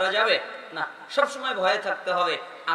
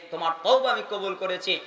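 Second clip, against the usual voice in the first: normal talking voice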